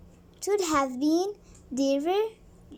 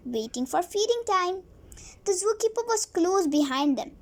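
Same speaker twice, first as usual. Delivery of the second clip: usual speaking voice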